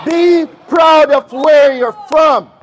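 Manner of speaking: loud, high and slow; rallying shout. Real, not acted. Host telling the audience to be proud, shouting slowly. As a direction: preach it to the crowd. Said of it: "rallying crowd".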